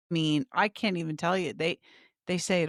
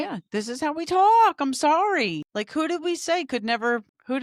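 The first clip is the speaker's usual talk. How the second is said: high, loud unapologetic insistence; loud protest; 8 semitones higher, 4 dB louder than usual. Real, not acted. Co-host defends Jersey cursing to her friend, loud and insistent. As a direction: belt it out in self-defence.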